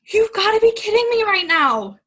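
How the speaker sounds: exasperated, can't believe what she heard; retold in a loud, high, stunned voice; incredulous. Retelling her disbelief to him.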